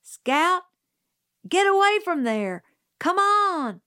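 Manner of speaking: high, loud urgent call. A narrator's reading. Jim shouts to Scout near the Radley steps. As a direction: yell a warning from afar. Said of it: "heard Jim's voice".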